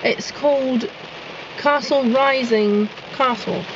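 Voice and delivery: put-on British accent, slow on the name; British accent with broad "ah" in Castle. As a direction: announce it in mock-British English. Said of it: "Oh, my accent".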